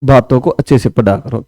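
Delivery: slow, calm and unhurried; softly slowing right down. Shown as a demonstration. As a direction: ease each word out slowly. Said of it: "आराम से शांति से".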